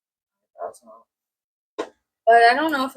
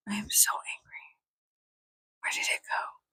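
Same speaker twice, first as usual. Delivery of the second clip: much softer, toneless mock anger; whispered grumble; 11 dB quieter than usual. Real, not acted. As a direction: hiss it under your breath. Missing coffee, hushed complaint.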